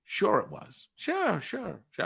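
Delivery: sarcastic disbelief. Retold or imitated. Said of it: "this is disbelief".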